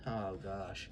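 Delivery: ordinary delivery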